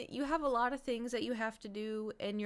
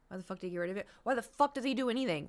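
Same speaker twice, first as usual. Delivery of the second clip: normal talking voice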